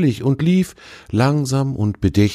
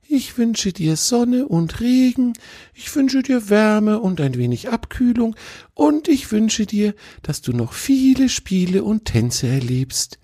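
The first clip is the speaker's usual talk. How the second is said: put-on young deer voice. A narrator's reading. The fawn Tjawe blesses his old tree friend, high and sweet. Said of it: "Tjawe sagte dann immer".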